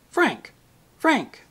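high, sharp call of his name; ringing cry. A narrator's reading. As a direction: call out to break through to him. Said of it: "cried in a voice that made the whole wood ring".

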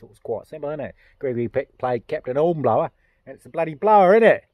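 mock English accent building to a loud finish. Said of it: "Heard it from an English guy".

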